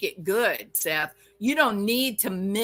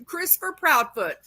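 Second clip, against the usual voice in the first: high, fast mocking voice; mocking, higher pitched singsong; mocking defenders; 4 semitones higher than usual. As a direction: sneer it out like the apologists. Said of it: "Everybody's acting like".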